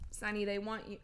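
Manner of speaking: ordinary talk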